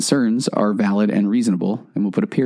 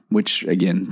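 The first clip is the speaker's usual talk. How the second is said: usual speaking voice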